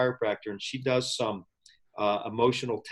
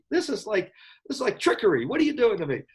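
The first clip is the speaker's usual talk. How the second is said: incredulous; 10 semitones higher than usual. Retold or imitated. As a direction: protest as if being tricked. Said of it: "I'm like".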